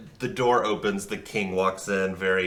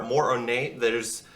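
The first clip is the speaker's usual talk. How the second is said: usual speaking voice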